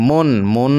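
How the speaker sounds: মুন clipped short; the wrong way to read মুন, short; ভুল ছোট উচ্চারণ মুন. Shown as a demonstration. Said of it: "এভাবে না".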